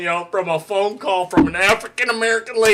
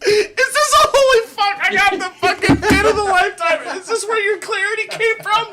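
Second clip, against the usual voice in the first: excited teasing; 11 semitones higher than usual. Real, not acted.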